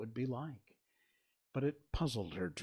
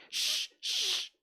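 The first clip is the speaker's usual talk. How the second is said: forceful drawn hush noise; loud shushing; 6 dB louder than usual. A narrator's reading. Hatter and March Hare silencing Alice, loud shushing. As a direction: shush them loudly, twice. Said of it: "the Hatter and the March Hare went".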